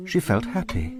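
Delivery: usual speaking voice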